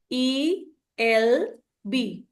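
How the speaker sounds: slow ई, एल, बी with gaps; counting back letter by letter. Shown as a demonstration. Teacher counting back three from the word's end, slowly spelled. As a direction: spell backwards, one spaced letter at a time. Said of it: "count back three".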